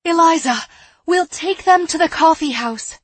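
loud, high, eager announcement; excited cry. A narrator's reading. Girl telling woman her sudden plan, crying out. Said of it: "I cried".

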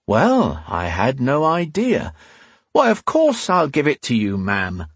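high-pitched, exaggerated fake innocence; mock surprise. A narrator's reading. Man goading the angry woman, in mock surprise. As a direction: act amazed, laying it on thick. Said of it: "he asked in mock surprise".

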